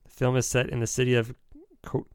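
ordinary talk

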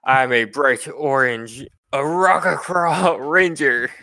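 rising to a slow, high squawky boast; bird ranger character. Acted. Bird ranger boasts of his kind to the party, hammed-up character voice. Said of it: "he says to the three of you".

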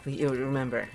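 ordinary delivery

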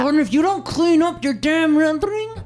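high, loud scolding; mock mom yelling. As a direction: yell at the kid like a mom.

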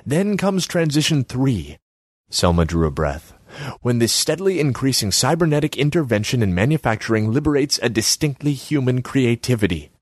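Selma's voice. Acted. Selma needles Borislav about his empty kiosk, high voice. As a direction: pitch her up high and needling.

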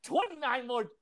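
high, soft comic whimper; mock-tearful countdown.

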